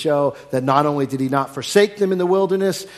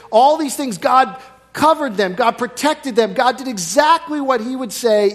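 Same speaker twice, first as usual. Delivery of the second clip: rising, louder fervour, higher; emphatic preaching; 10 semitones higher, 6 dB louder than usual. Real, not acted. Preacher marvelling to the congregation at God's care, fervent. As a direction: drive it home with fervent joy.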